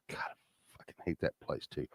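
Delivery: a hushed, voiceless grumble; whispered aside. Real, not acted. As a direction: whisper it under your breath. Aside about hating LAX.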